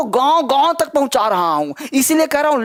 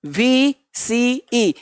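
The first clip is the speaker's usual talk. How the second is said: spelled out slowly; much slower than usual. Shown as a demonstration. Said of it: "यू देखिए".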